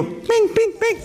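high, chirpy imitated beep bing bing bing; playful mock beep of a heat button. Imitating the heater button sound.

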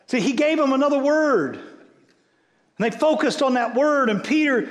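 high, loud, driving sermon voice; preaching at full force. Acted. Pastor urging the congregation to focus, full-force preaching. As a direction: preach it out hard to the room.

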